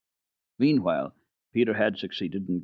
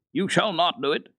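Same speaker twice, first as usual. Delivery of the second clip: high, firm indignant command; girl indignantly forbidding the boy to strike; indignant cry; 8 semitones higher than usual. Acted. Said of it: "cried indignantly".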